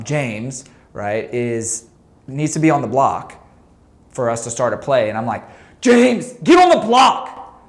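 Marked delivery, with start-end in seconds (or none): 5.8-7.6 much higher, much louder barked command; furious court shout at a teammate; angry yell at teammate. Retold or imitated. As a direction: yell it at him like he's an idiot. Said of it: "What I actually just said to James in that tone".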